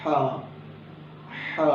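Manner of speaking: a teacher sounding ہا; throat letter demo. Shown as a demonstration. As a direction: push ہا out from the throat. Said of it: "میں بتاتا ہوں".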